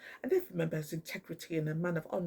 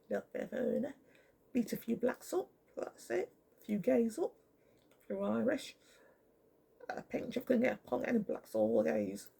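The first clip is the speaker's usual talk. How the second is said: lightly voiced sneering cop, pitched up; mock bent copper; 5 semitones higher than usual. Mocking a racist police officer.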